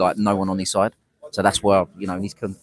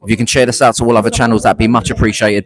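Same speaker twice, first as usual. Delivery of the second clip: loud, higher, projected livestream welcome; louder host voice; 4 semitones higher, 12 dB louder than usual. Real, not acted. Host welcoming viewers to a livestream, loud and projected. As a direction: raise your voice to rally the viewers. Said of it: "little bit louder".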